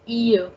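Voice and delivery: "ear" said as ee-yuh, no L; French "ille" sound. Shown as a demonstration. Teacher showing the E double L E sound, "ear" as ee-yuh. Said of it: "the sound that we are having is".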